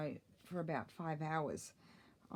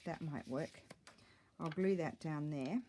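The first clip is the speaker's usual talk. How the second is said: ordinary delivery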